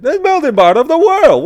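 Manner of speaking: high, loud, cartoonish kid-show delivery; Dora the Explorer voice. Comic mocks his own phrase as a cartoon explorer, high and loud. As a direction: sing it like a children's cartoon host. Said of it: "What am I, Dora the Explorer?".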